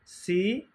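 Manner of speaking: সি with a thin সিন s, lips spread; light s sound. Shown as a demonstration. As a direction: say সি thinly like সিন. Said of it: "সিনে".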